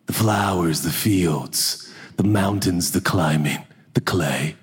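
low, drawn-out earthy reverie; mock earthy Northwesterner. Critics imitating Judah's Northwest tone, low mock-earthy listing. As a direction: savor each item in a deep mock-earthy tone. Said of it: "We can hear it in his tone".

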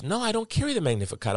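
put-on very high-pitched woman with a Wisconsin accent; thick Wisconsin accent. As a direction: imitate her in high, broad Wisconsin. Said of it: "the thickest Wisconsin accent you ever heard".

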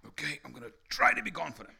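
putting on try-hard confidence; forced confidence. Acted. Preacher mimicking someone mustering up confidence, forced and quick. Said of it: "like, sound arrogant if you do it".

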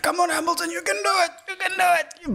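high, excited cheering for Hamilton; root for him, voice way up high. Retold or imitated. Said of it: "shouting at the T V".

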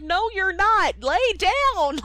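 high, loud, slow mock father; stubborn Geppetto. Geppetto telling Pinocchio to lie down, high and hammed-up. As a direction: order him back to bed, hamming it up. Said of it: "Geppetto's like".

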